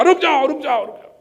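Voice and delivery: very high, loud repeated command; urgent calling out. Acted. Priest stopping the man with the sword, urgent cry.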